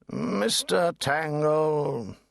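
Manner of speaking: drawn-out, weary Lord Chancellor; a lightly voiced old judge, slow and weary. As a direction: address the lawyer with tired dignity.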